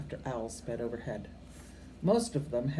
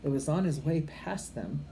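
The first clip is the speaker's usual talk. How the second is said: plain reading voice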